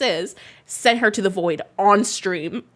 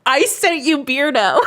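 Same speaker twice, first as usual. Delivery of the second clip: half-crying, half-laughing shout, louder; 6 semitones higher, 5 dB louder than usual. Real, not acted. To Lex, confessing she sent Beardo, laughing shout. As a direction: blurt it out through real laughter.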